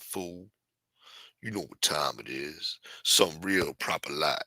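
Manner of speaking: low, soft, slow gangster voice; cool, menacing, taking your time; West Coast gangster. Pretend. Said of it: "West Coast gangster shit?".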